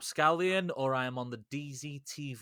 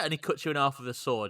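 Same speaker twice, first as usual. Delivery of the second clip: ordinary delivery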